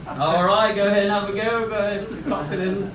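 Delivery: hammed-up English accent gone Australian; mock English-Australian accent. Friend urging his mate to talk to the waitress, mock English-Australian accent. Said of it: "the accent you were doing was clearly Australian".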